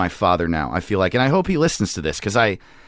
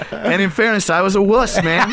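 high, laughing confession; real laughter bubbling through the words; laughing admission; 12 semitones higher than usual. Real, not acted. Laughing while admitting being a wuss.

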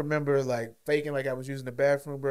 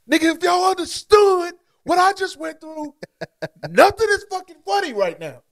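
higher, louder exasperated yell; angry shout; 7 dB louder than usual. Retold or imitated. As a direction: yell at them, half laughing. Angry retold thought, shouting.

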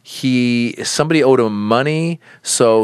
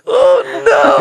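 laughing oh no; 6 dB louder than usual. Real, not acted. Dad reacting, laughing.

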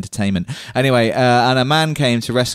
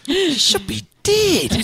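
slow, much higher mock Scottish voice; a laughing go at the Scottish line; laughing Scottish accent; slower than usual. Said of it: "in a Scottish accent".

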